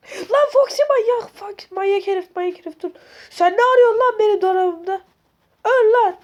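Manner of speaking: high, loud screams at Foxy; scared, angry screaming; terrified shouting. Real, not acted. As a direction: yell in panic and fury.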